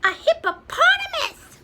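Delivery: very high, loud squeal of a fox; startled fox. Acted. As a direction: squeal it out in shock. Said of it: "said the fox".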